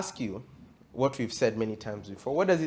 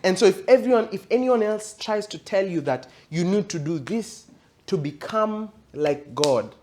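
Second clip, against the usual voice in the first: raised, emphatic sermon voice building in intensity; fervent preaching; 5 semitones higher, 5 dB louder than usual. Acted. Preacher urging the congregation about the ultimate deception, fervent preaching. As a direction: drive it home to the congregation.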